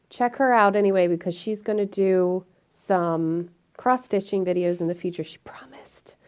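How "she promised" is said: hushed playful whisper. Acted. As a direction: whisper it like a secret.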